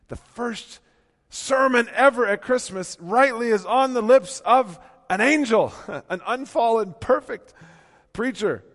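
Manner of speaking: loud, high, ringing preacher's climb; full-voiced pulpit proclamation; preaching at full force. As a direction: lift it up and proclaim.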